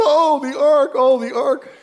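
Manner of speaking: high wailing mock panic, fading; hammed-up frantic Israelites. Preacher mocking Israel fretting over the ark, high wail. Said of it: "God wasn't going".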